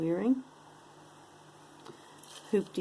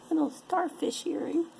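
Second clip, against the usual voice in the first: high cute voice; 11 semitones higher than usual. Pretend. Cute item voice.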